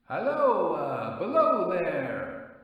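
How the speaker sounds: high, drawn-out call from the top of a cutting; calling down from above. Acted. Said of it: "a voice thus calling to him".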